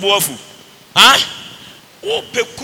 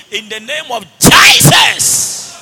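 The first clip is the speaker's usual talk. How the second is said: slow build to a loud, hammed-up shout; mock prayer warrior; 8 dB louder, much slower than usual. Preacher caps imitation of binding prayers, mock fervent shout. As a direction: blast the last word like overdone prayer. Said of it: "after you do all the crazy gymnastics".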